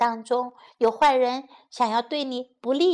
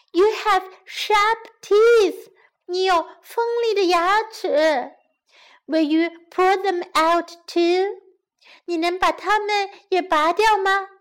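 put-on wary young girl; 6 semitones higher, 4 dB louder than usual. Girl pointing out the lion's claws, high wary girl voice. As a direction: pitch it up as a worried girl.

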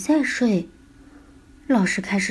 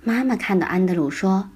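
usual speaking voice

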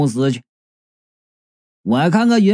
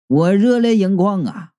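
ordinary delivery, nothing marked

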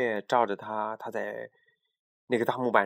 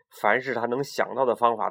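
usual speaking voice

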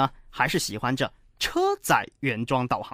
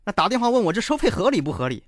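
ordinary talk, nothing marked

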